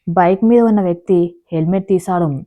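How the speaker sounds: ordinary delivery, nothing marked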